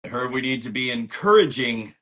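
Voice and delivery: ordinary talk, nothing marked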